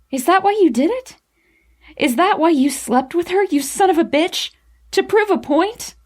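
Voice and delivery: high-pitched, hurt accusation; voice cracking in anger. A narrator's reading. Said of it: "My voice cracked in my anger".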